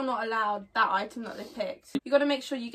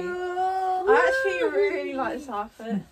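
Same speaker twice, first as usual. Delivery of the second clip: high, slow and pleased; delighted; 4 semitones higher, 6 dB louder, much slower than usual. Real, not acted. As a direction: gush over the outfit. Delighted with the outfit.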